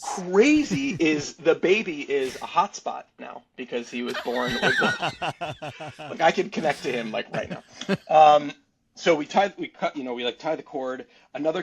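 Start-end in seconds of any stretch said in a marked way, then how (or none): 0.0-6.2 slowed, laughing through the punchline; laughing joke. Real, not acted. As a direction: laugh while setting up the joke. Joke about the baby hotspot.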